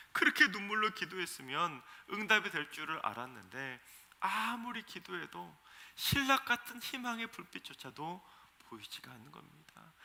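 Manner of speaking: normal talking voice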